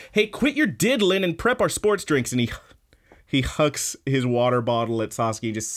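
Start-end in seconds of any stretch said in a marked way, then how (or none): 0.1-2.3 high mock jealous boy voice; jealous yell. Ida scolds the jealous team, in a high mock squeak. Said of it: "He says".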